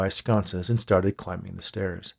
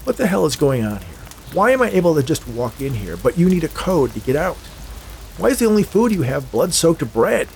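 higher-pitched, frustrated questioning; exasperated; 6 semitones higher than usual. A narrator's reading. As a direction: demand answers, fed up. Exasperated prisoner questions silent monk.

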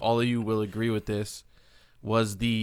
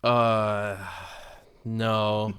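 ordinary talk, nothing marked

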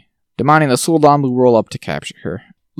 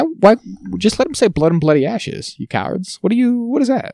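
high, exasperated comic rant; pitched-up indignant plea; mock outrage; 8 semitones higher than usual. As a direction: scold the cowards in disbelief. Mock rant at show makers.

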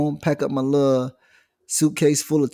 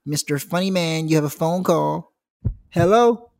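higher sarcastic teacher voice; smug teacher; 5 semitones higher than usual. Retold or imitated. Teacher passing the phone to the class clown, sarcastic. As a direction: hand over the phone with a smirk.

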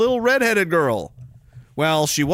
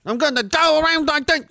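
put-on silly accent for David Suzuki, much higher; mocking the casting with a high put-on David Suzuki accent; 11 semitones higher than usual. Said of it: "I'm gonna make him one accent".

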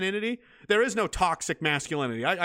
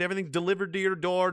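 ordinary delivery

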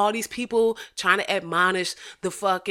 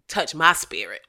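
normal talking voice

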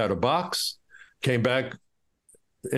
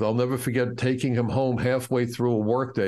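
ordinary delivery

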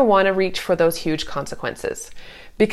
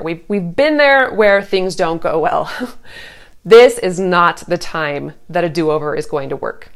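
ordinary talk

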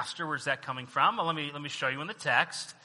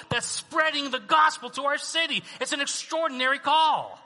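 high, raised, impassioned sermon delivery; full-force preaching; 10 semitones higher, 6 dB louder than usual. Real, not acted. Pastor telling the church of its extraordinary call, preaching forcefully. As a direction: drive it home to the whole church.

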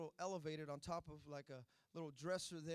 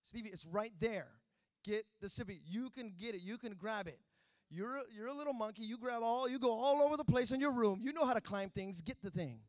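coaxing, building; 4 semitones higher, 8 dB louder than usual. Retold or imitated. I'm getting frustrated now.

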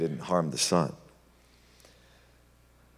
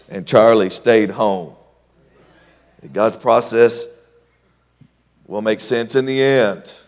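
full preaching; 4 semitones higher, 10 dB louder than usual. Acted. Preacher exhorting the congregation to trust God. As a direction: drive the point home to the church.

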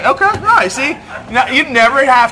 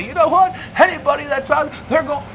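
harsh, higher judging tone; not nice; 9 semitones higher than usual. Pretend. Preacher judging outsiders harshly, not nice, higher. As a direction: condemn them sharply. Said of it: "not be very nice about it".

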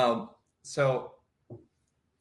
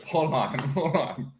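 usual speaking voice

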